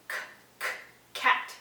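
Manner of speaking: put-on k, k, cat sounded out for a child; letter sound demo. Shown as a demonstration. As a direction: model the hard k sound. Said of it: "You can do the sound too".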